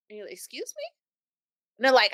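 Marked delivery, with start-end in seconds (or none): 0.3-0.8 tiny, high, taken-aback voice; very soft, high mock shock; confused. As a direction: act out hushed disbelief. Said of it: "you're still confused".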